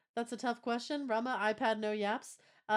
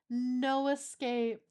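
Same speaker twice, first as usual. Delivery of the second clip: slow mock menace; 2 semitones higher, 3 dB louder, much slower than usual. Repeats it drawn out.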